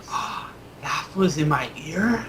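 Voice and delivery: put-on slow, high little-kid wonder; amazed child. Kid finding a coin came from his ear, wondering. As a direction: ask it slowly in a high child's voice.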